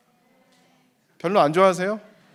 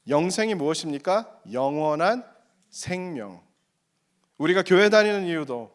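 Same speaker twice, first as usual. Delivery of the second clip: normal talking voice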